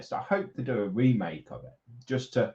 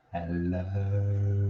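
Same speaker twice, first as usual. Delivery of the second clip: drawn-out greeting; 3 semitones lower than usual. Pretend. Playing at greeting the viewers.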